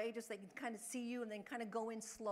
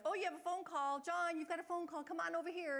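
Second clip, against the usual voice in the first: higher, lively and a touch louder; bright call-over; 7 semitones higher, 3 dB louder than usual. Retold or imitated. Caregiver redirecting John with a pretend phone call, bright and eager. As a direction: lure him over with happy news. Caregiver calling a resident away.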